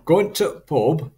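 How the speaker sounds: slow, loud Yorkshire accent; drawn-out Yorkshire with a clipped "to". Shown as a demonstration. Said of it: "this is definitely from Yorkshire".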